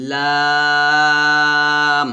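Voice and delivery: লাম light, vowel held long and level; long-drawn, unforced লাম; light normal pronunciation. Shown as a demonstration. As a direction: stretch লাম out evenly with a light L. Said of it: "হালকা নরমালভাবে উচ্চারণ করবো".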